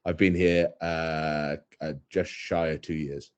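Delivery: ordinary talk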